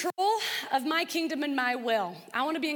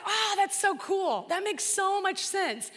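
delighted; 6 semitones higher, slower than usual. Retold or imitated. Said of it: "And I said".

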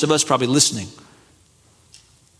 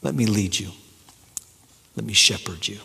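much softer and lower, an intimate offer; gentle closing plea; 9 semitones lower, 7 dB quieter, faster than usual. Acted. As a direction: speak it close, like consoling someone tired. Closing invitation in Jesus's voice.

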